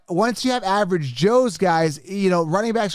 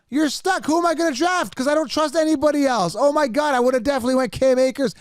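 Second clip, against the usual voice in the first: much higher, rushed disbelief; exasperated; 6 semitones higher than usual. Real, not acted. Streamer to chat about having no one left to draft, high exasperated. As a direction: throw up your hands in despair.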